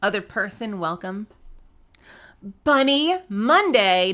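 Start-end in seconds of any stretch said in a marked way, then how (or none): 2.6-4.1 loud, high, drawn-out call; excited greeting. Real, not acted. Streamer hailing a viewer on Monday, excited. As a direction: stretch the name out loudly and high.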